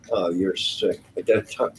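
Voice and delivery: ordinary delivery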